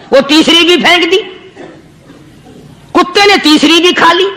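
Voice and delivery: ordinary talk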